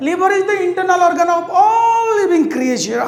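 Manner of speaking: mock him with a loud lecture; shouted scolding. Monkey lectures crocodile.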